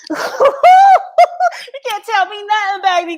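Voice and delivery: laughing, high and loud; proud cackling boast about her melanin; laughing brag. Real, not acted. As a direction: crow it through real laughter. Bragging about her skin.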